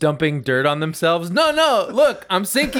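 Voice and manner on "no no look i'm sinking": high, mock-desperate plea; pretend panic. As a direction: act like you're sinking, voice pitched way up. Acting girls sinking at beach.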